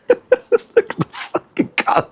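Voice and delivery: breaks into laughter, high and breathless; laughing. Real, not acted. Cook laughs at the gammon's dark colour, giggling. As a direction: laugh the words out in disbelief.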